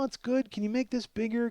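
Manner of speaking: ordinary talk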